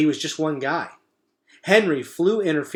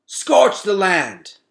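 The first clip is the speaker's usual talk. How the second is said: slow, high, loud yelled command; 7 semitones higher, 9 dB louder, much slower than usual. A narrator's reading. Henry ordering the beach burned, slow loud yell. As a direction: bellow the order as you fly off. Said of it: "took off yelling".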